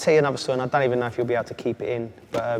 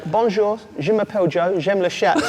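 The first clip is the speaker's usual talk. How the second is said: "j'aime le chat" said brightly, higher and louder; proud naive greeting; 7 semitones higher, 6 dB louder than usual. Retold or imitated. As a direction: greet the whole team proudly in schoolboy French. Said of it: "I'm walking in and going".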